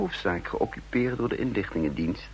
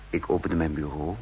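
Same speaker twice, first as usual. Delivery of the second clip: ordinary delivery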